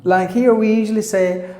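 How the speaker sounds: ordinary delivery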